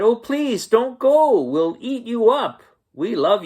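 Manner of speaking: high, loud begging wild things; pleading wild things. A narrator's reading. The wild things beg Max to stay, high pleading cry. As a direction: wail at Max not to leave, high and loud. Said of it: "the wild things cried".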